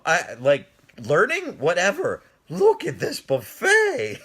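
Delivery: loud, high, slow gloating over the food; gleeful. Real, not acted. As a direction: show off the buffet with glee. At the buffet instead of learning.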